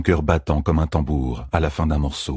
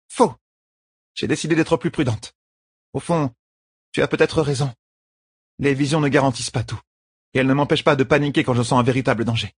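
higher-pitched, lightly voiced queen; higher voice, reasoning with her bodyguard; self-justifying young queen; 7 semitones higher than usual. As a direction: defend yourself, then concede a little. Voices Elaine justifying herself.

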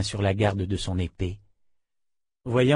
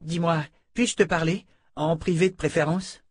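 pleading; 6 semitones higher than usual. A narrator's reading. Fitch pleading privately.

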